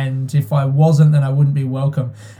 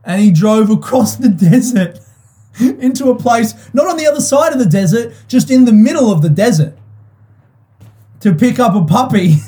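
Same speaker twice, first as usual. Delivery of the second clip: amazed disbelief; 7 semitones higher than usual. Real, not acted. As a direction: voice up high, laughing in disbelief. Retelling dad's absurd desert drive.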